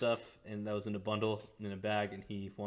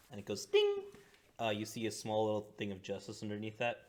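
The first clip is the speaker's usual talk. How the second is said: ordinary delivery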